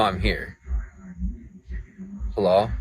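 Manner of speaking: ordinary talk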